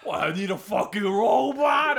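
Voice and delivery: high, loud grumpy shout. Retold or imitated. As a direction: yell it in a complaining way. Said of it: "he's like".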